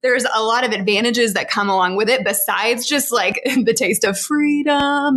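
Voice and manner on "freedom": playful mock battle cry, drawn out; lightly imitated movie-hero cry, higher and stretched; imitating Jordan. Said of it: "as Jordan would say".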